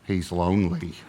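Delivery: low, soft, deadpan verdict; mock solemn hush. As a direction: say it low and gravely, a mock expert. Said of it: "here's what the guy said".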